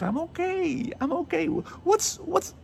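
very high, exasperated mock protest. Man imagines confronting a girl's demanding mother, exasperated. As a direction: push the voice way up, protesting in disbelief.

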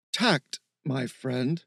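slow, stuffy professor voice. Acted. Steve joking with his friend about tact, stuffy professor. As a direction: draw it out like a pompous professor. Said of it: "said in a stuffy professor's voice".

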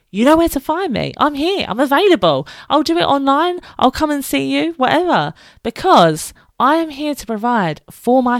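bright, high, eager sales pitch; excited pitch. Real, not acted. Mum pitching her mental health training to listeners, excited. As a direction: sell yourself with bouncy enthusiasm.

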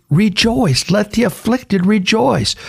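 proclaiming. Retold or imitated. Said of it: "He said".

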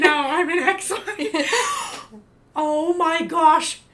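giggling through the line; laughing quote. Retold or imitated. Quotes Mean Girls, then gasps.

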